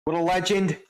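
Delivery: high mock-Aussie voice; Australian accent. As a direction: exclaim it as a put-on Australian. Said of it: "maybe just do the Australian".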